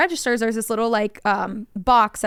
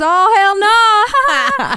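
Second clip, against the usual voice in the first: very slow, high, loud cry of refusal; outraged mama; 9 semitones higher, 8 dB louder, much slower than usual. Retold or imitated. Mama refusing her kid a snake, drawn-out shriek. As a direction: wail a horrified no.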